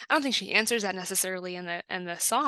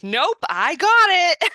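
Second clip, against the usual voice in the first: mock-stubborn refusal, loud and high; stubbornly convinced; 10 semitones higher, 10 dB louder, slower than usual. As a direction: belt it out, drawn out, playing someone who won't take help. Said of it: "I am stubbornly convinced".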